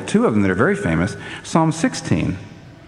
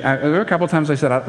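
ordinary talk

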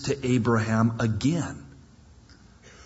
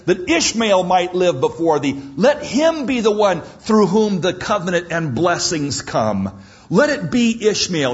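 louder and higher, pressing every phrase; emphatic plea; 5 semitones higher, 3 dB louder than usual. Acted. Abraham pressing God emphatically, louder and higher. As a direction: insist on it. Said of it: "He says emphatically".

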